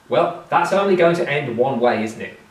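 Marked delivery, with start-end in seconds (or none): none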